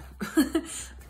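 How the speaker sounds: breathy laugh. Real, not acted. Wry laugh about teeth.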